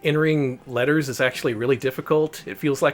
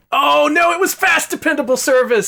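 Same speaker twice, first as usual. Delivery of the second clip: dismayed shout; 9 dB louder than usual. Real, not acted. Wrong answer just revealed.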